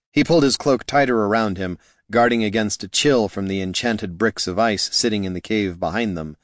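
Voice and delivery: plain reading voice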